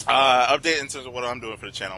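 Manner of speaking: usual speaking voice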